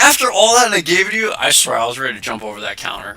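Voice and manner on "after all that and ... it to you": much higher, louder outraged cry; can't believe she just did that; indignant disbelief. Retold or imitated. Clerk hit by the thrown card, outraged disbelief.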